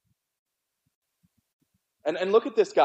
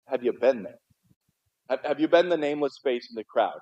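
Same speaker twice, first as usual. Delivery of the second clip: ordinary talk, nothing marked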